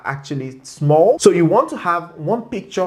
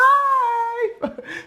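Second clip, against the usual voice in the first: high squeal of laughter. Real, not acted. Laughs after number five.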